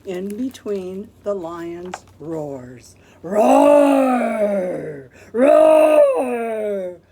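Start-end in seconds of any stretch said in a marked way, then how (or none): 3.2-7.0 loud long mock roar. Lion roars at the zoo.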